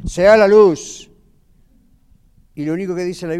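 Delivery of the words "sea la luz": loud, drawn-out divine command; voz de Dios. Acted. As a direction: proclaim it slowly, loud and high. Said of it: "cuando en Génesis Dios dice". (voz de Dios, orden fuerte y solemne)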